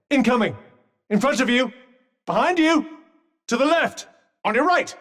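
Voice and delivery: high, quick-fire callouts; urgent warnings. Acted. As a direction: call out where attacks come from. Calling attack directions.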